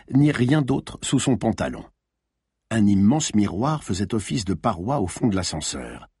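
usual speaking voice

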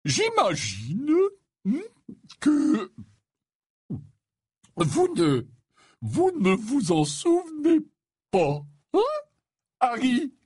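hesitant, halting question with long pauses; awkward old man fumbling for words. A narrator's reading. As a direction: feel your way clumsily towards the question. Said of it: "demanda-t-il maladroitement".

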